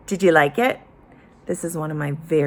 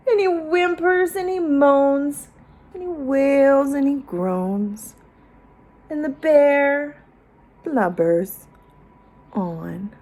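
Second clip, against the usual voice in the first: higher, whiny blubbering tone; weepy narration; 7 semitones higher than usual. A narrator's reading. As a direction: tell it with a whimper. Bear still blubbering.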